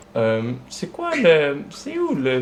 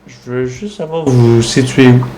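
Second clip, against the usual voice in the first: loud insistent; 2 semitones lower, 12 dB louder than usual. Pretend. Caller insists loudly on prank.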